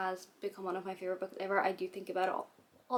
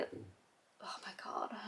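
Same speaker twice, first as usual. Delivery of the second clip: whispering; 13 semitones lower, 8 dB quieter, much slower than usual. Real, not acted. Hiccup interrupts her mid-sentence.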